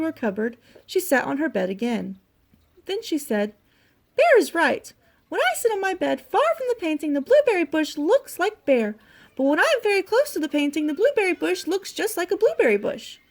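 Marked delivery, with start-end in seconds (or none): 4.2-13.2 high little mouse voice; Little Mouse, pleased. A narrator's reading. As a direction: work it out aloud, pitched up and lively. Little Mouse reasons aloud.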